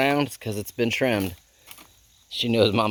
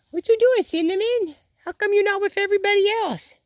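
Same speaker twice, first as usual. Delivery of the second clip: very high, sing-out call to an animal; calling a chicken; 3 dB louder than usual. Real, not acted. Owner asks her straying chicken why it's alone, high pet-calling voice. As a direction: coax loudly in a tiny high-pitched voice.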